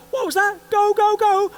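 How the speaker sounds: high, loud barked orders; instructor yelling go. Retold or imitated. Instructor yells at the jumper to go, loud. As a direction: shout him out the door. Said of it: "This guy goes".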